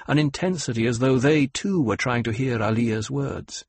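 plain reading voice

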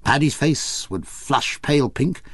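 plain reading voice

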